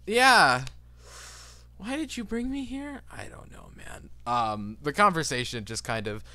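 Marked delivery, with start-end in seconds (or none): 0.1-3.0 high, loud plaintive whine; a high-pitched dude whining at his friend; whiny complaint. Acted. 3.1-4.1 whispered, barely voiced shrug; a whispered, sheepish dude. Friend mumbles back.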